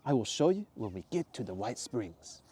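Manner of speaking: put-on slow, accented teasing promise; slow secretive Mateo. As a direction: hold back the secret in the accent. Mateo voiced slowly.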